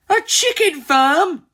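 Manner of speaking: high, slow panicked cry; frightened cry. Acted. Frightened birds crying out in alarm. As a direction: gasp it out like scared hens. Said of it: "cried the frightened birds".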